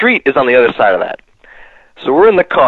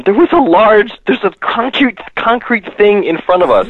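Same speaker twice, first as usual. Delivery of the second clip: protesting friend; 8 semitones higher than usual. Retold or imitated. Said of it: "Todd keeps telling him".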